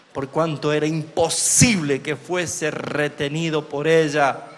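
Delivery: loud, slow preaching at full force; preacher proclaiming the resurrection, slow and booming; fervent scripture reading. Acted. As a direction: thunder the verse out slowly to the congregation.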